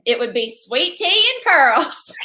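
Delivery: slow, drawn-out Southern accent on the show name. Shown as a demonstration. Co-host says the show name with a slow Southern accent. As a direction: stretch it out like a Southern belle. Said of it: "You gotta say it with that Southern accent".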